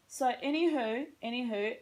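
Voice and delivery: whispered American "anywho", rising at the end; "anywho" in a light American accent. Shown as a demonstration. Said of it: "how I say anywho like an American".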